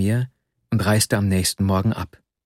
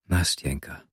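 softly and lower, coaxing; a soft, lower coaxing call of her name; 5 semitones lower, 7 dB quieter than usual. A narrator's reading. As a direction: coax her gently by name. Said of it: "mit leiser, einschmeichelnder Stimme".

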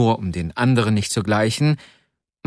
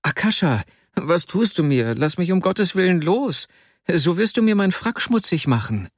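höher, hilflos flehend; flehender Vasja; 7 semitones higher than usual. Acted. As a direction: ein schwacher Freund bettelt, höher. Vasja pleads while held. (Vasja fleht den Freund höher an, losgelassen zu werden)

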